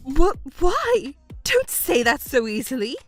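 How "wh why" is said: high, stammered disbelief; flustered shock. Acted. Mother reacts to a flat refusal, flustered. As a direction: demand a reason, taken aback.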